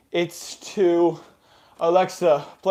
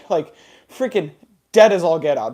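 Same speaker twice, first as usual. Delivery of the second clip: ordinary delivery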